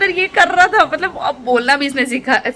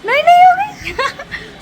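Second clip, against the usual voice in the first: much higher, bouncy sing-song. Real, not acted. Mother playing with her baby. (बच्चे से खेलते हुए ऊँची चहकती आवाज़)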